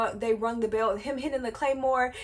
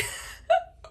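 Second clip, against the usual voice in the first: high laugh. Real, not acted. Laughing at the taunt.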